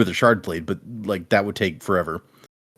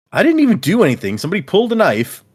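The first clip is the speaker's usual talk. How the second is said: indignant protest; 6 semitones higher, 3 dB louder, faster than usual. Retold or imitated. Rock indignant, defending himself.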